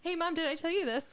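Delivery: put-on high, giggly kid request through laughter; laughing while quoting. Mom laughing as she quotes her daughter stalling. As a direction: laugh your way through the kid's line.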